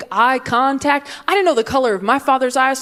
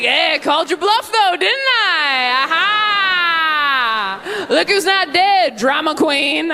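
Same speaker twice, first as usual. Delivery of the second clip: gloating dad; 6 semitones higher, slower than usual. Retold or imitated.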